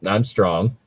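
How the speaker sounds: ordinary delivery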